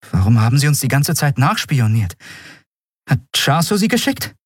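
high-pitched, pressing questions, climbing at the end; suspicious questioning. A narrator's reading. Prisoner questions the man who spied on her, suspicious. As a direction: press the stranger for an explanation.

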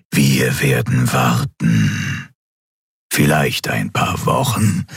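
slow, low dark emperor voice; deliberate, menacing overlord in a deep register; dark emperor, brooding. Acted. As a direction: draw the words out low, like a patient shadowy tyrant.

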